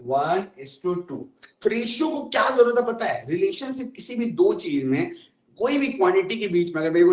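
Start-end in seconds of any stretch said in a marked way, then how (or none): 0.1-1.2 slow, soft, deliberate answer; teacher unveiling the ratio; slow reveal. Shown as a demonstration. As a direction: spell out one is to two. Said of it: "तो अब क्या बोलोगे?".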